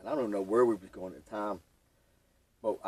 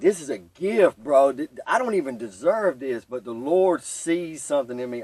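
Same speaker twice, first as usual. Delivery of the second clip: louder, emotional thanks; grateful outburst; 3 semitones higher, 7 dB louder than usual. Retold or imitated. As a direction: pour out gratitude like a prayer. Retold grateful prayer-like thought.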